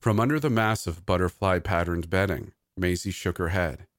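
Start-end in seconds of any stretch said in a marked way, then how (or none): none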